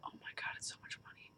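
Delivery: a dismayed whisper about the money; whispered aside. Real, not acted. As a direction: whisper it under your breath. Shocked aside about the cost.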